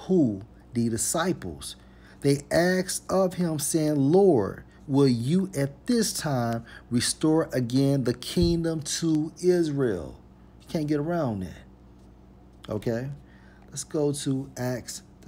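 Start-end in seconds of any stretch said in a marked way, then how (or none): 2.2-10.2 higher, emphatic preacher's reading; emphatic scripture reading. Acted. Preacher reading the disciples' question to listeners, higher and emphatic. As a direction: proclaim the verse at a higher pitch.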